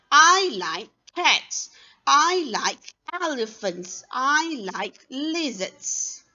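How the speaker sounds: slow model sentences, I high and stretched; practice sentence reading. Shown as a demonstration. Teacher swaps animals for practice, slow lifted intonation. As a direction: lift I, slow down for each animal. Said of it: "You can change the dogs to cats".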